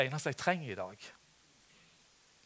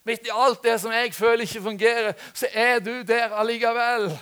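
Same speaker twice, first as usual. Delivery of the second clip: impassioned plea, building louder and higher; raised-voice demonstration prayer, pressing on; crying out to God; 6 semitones higher, 8 dB louder than usual. Acted. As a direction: call on God with mounting urgency. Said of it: "Vi må rope på Gud".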